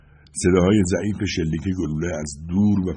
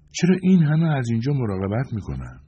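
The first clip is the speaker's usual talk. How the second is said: higher-pitched character voice, asking; Joanna's voice; 6 semitones higher than usual. A narrator's reading. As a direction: pitch the question up, unlike the narrator. Voicing Joanna, companion asking a question. (صدای زیرتر برای شخصیت همراه)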